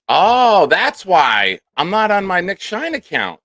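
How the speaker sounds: a loud, high "oh" as it dawns on him; catching his own mistake out loud; sudden realization. Real, not acted. Streamer realizing he's on the wrong account, loud surprised.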